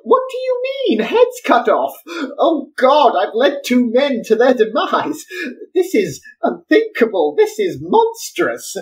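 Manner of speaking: high shocked outcry; horrified. Acted. Engineer horrified that two men will be beheaded, high outcry. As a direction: recoil in horror.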